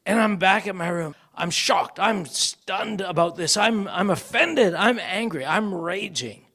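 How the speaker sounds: normal talking voice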